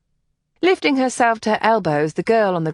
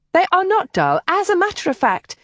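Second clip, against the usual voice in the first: quick, high, defensive objection; indignant protest; 11 semitones higher, 3 dB louder than usual. Acted. Kathleen objecting to Devon's insult, high and indignant. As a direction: bristle at the insult to the girls.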